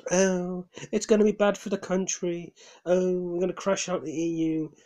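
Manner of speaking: high, whiny mock panic; mocking scared Remainers. Mocking Remainers who fear leaving the EU, in a high whiny voice. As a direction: whimper it like a frightened Remainer mocked. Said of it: "people are too scared to leave the E U".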